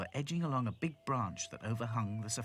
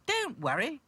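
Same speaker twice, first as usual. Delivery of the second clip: high, loud Dotty voice; Dotty reassuring; 5 dB louder than usual. Acted.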